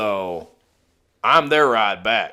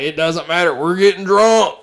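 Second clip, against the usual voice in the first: rising, louder carefree cheer; gleeful drunk buddy; excited quote; 6 semitones higher, 7 dB louder than usual. Retold or imitated. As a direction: brush it off with glee.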